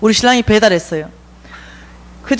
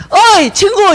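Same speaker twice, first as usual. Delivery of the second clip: calling out; 9 semitones higher, 3 dB louder, slower than usual. Retold or imitated. Homeless friend hails her.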